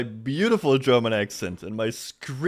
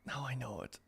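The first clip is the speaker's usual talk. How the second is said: much softer, breathy whisper to herself; 17 dB quieter, faster than usual. Real, not acted. Streamer repeating the found word to herself in a whisper. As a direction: murmur it under your breath.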